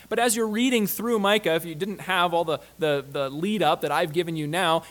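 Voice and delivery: ordinary delivery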